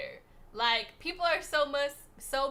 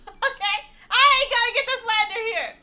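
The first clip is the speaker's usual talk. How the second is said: loud laughing protest; 12 semitones higher, 13 dB louder than usual. Real, not acted. Laughing, defending herself to chat.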